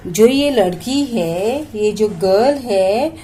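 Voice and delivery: normal talking voice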